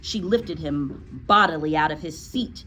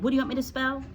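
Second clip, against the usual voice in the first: put-on high, hesitant child voice; puzzled schoolboy; 7 semitones higher, much faster than usual. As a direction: sound like a confused small pupil. Confused Eric answers.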